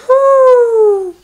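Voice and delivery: high, loud whooshing wind noise; howling wind. Acted. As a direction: howl like the winter wind. Imitating wind in the wood.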